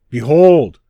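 booming, commanding outcry; loud cry. A narrator's reading. Divine metronome smashing the prince, crying out loudly. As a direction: cry it out as a god's proclamation. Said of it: "cried the divine metronome".

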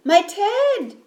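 high, loud gasped exclamation; storybook gasp. A narrator's reading. Bear spotting its lost teddy, gasping. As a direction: gasp it out in sudden shock. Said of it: "gasped the bear".

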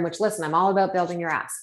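ordinary talk

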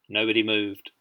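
ordinary delivery, nothing marked

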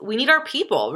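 ordinary delivery

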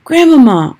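high, loud call for grandmother; boy in the tree calls his grandmother again; shouted. A narrator's reading. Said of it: "I shouted again".

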